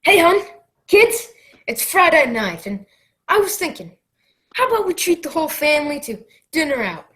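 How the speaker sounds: dad announcing family dinner out; cheery sitcom dad. Acted. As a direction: sell the dinner plan brightly.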